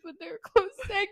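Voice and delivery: ordinary talk, nothing marked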